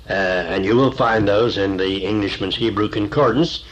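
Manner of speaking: ordinary talk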